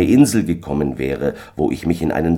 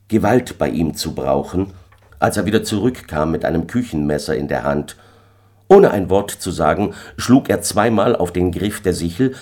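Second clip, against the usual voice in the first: usual speaking voice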